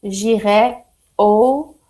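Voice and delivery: careful French accent on j'irai au supermarché. Shown as a demonstration. Teacher modelling a French accent for the class. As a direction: lean into the French accent, slowly. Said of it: "get my accent in there".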